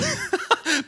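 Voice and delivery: sudden high laugh breaking out; burst of high laughter. Real, not acted. Pastor laughing at Jesus' blunt words, bursting into high laughter. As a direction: laugh out loud at the harsh rebuke.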